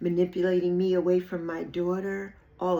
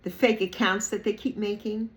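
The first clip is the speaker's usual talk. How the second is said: normal talking voice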